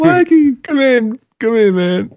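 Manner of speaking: high excited stoner bro greeting; gym bro. Retold or imitated.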